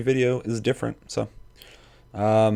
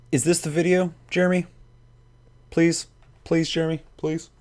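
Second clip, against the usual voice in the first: higher-pitched questioning plea; pleading; 7 semitones higher than usual. Real, not acted. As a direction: beg for an answer in short, raised questions. Begging Jeremy for an answer.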